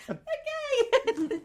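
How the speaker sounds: high, squeaky mock shock; a startled little okay; taken aback. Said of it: "I was like".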